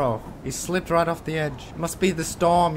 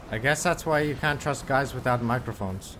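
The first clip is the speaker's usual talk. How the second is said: usual speaking voice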